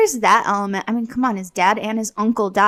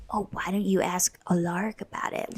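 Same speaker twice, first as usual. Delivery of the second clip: mock whispered suggestion, planting doubt; sly insinuation; 4 semitones lower, 10 dB quieter than usual. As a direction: whisper it slyly, imitating a troublemaker. Mimicking John slyly stirring trouble.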